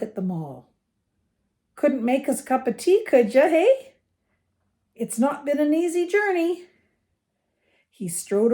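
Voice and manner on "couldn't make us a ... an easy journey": higher, folksy giant's voice; hearty giant stranger. A narrator's reading. Giant stranger asks the family for tea, hearty and informal. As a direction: play a big visitor making himself at home.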